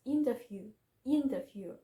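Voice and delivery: "interview" with a loud first syllable; "interview", stressed at the start and falling away. Shown as a demonstration. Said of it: "with stress on the first".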